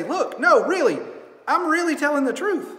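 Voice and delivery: put-on high, insistent child swearing it's true; pleading kid. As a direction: plead like a kid who isn't believed. Kid insisting he's truthful.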